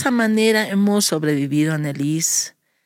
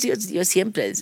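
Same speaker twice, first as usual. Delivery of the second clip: ordinary talk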